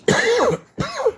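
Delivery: short rough cough; one real cough; chesty cough. Real, not acted. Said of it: "I'm coughing because".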